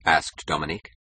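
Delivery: plain reading voice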